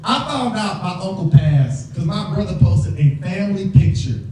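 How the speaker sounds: ordinary delivery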